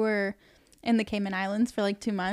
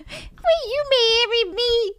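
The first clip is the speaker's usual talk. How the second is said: high, slow, whiny mock proposal; mocking whine; 12 semitones higher, 6 dB louder, much slower than usual.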